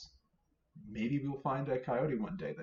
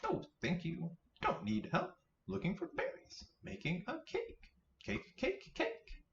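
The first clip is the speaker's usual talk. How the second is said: tiny squeaky bunny, much higher; little bunny voice; 11 semitones higher, 4 dB quieter than usual. Acted. Minnie Rabbit to Mother Rabbit, squeaky high bunny voice. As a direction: chirp it like an eager baby rabbit.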